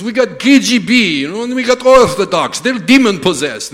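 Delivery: put-on loud, high Russian-accented rant; hammed-up Russian alarm; Russian driver. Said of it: "He says".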